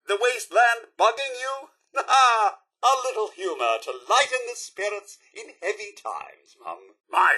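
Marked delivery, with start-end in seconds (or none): 2.1-2.6 short mock laugh. Robot butler laughs at own joke.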